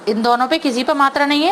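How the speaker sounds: ordinary talk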